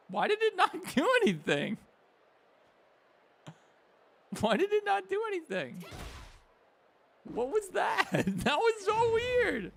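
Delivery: high, loud squeal of disbelief; baffled outburst. Real, not acted. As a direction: cry out in real bewilderment at the game. Baffled by a failed game move.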